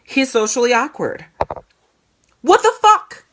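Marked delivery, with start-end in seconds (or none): 2.4-3.2 much higher, louder, What the fuck? blurted out in shock; shocked outburst. Real, not acted. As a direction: burst out in disbelief at what her son said. Shocked at son's self-label.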